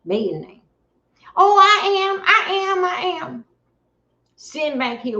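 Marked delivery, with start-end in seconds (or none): 1.4-3.4 Megan's answer voiced much higher and louder; mock reply. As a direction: play her brushing it off high and loud.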